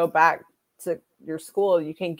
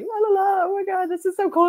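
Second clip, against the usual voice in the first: much higher, singsong starstruck gushing; giddy fangirling; 13 semitones higher than usual. Retold or imitated. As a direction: play the dazzled fan humming along. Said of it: "we're just like".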